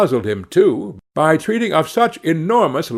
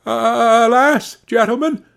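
high, plaintive exclamation of dismay; 9 semitones higher, 3 dB louder, slower than usual. A narrator's reading. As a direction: plead in mild alarm, pitched well up. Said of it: "somewhat dismayed".

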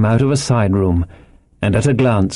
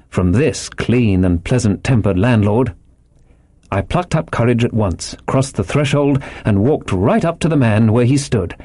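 usual speaking voice

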